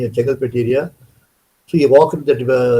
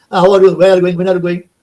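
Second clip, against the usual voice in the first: higher and louder, bright welcome; cheerful greeting; 5 semitones higher, 5 dB louder, faster than usual. Retold or imitated. Swami greets him upstairs in a higher, louder cheerful voice. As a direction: greet him with lively delight.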